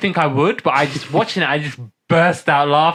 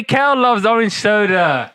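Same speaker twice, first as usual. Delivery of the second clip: higher Kel catchphrase; prompting the cohost with the line, higher catchphrase; catchphrase demo; 5 semitones higher, 3 dB louder than usual. Shown as a demonstration. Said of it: "you was meant to do it".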